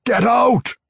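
high, furious roar; loud, menacing order to leave; Pwent. A narrator's reading. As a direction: roar it as an enraged dwarf. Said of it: "Pwent roared".

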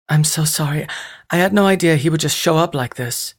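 high, flustered apologetic woman; anxious, rushed apology; distressed woman. Acted.

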